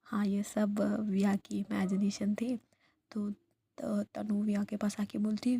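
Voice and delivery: ordinary delivery, nothing marked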